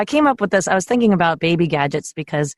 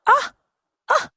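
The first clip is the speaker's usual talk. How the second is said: mock indignant; 9 semitones higher than usual. Mock outrage at new gadget.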